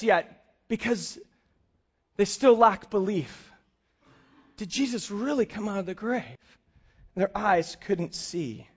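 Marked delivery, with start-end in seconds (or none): 4.6-8.7 quiet reflective preaching. Acted. Sermon reflection.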